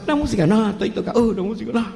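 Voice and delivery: put-on stubborn old grandpa shaking his head at it; grandpa forbidding music. Abuelo forbids his grandson's music, lightly voiced old man.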